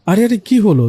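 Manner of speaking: the ghost gasps at her dancing; astonished. Acted. Ghost amazed as Mim dances, surprised outcry. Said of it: "অবাক হয়ে বলল".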